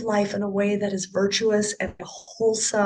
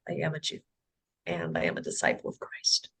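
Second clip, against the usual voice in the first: much softer and lower, solemn; quiet sincere vow; 7 dB quieter, faster than usual. Real, not acted. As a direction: say it like a quiet promise. Closing vow of faith.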